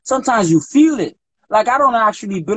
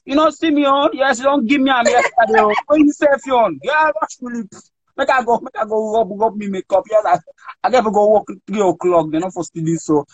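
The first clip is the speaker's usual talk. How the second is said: mock African accent; 5 semitones higher than usual. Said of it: "maybe then they sit down".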